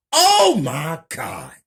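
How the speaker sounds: loud, high, drawn-out exclamation; stunned, high-pitched yell; shocked outburst. Acted. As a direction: cry out in slow, loud shock. Shocked reaction to news report.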